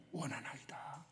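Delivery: unvoiced, barely audible answer; faint whispered request. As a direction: breathe the words out timidly. Beggar's answer, then corrected.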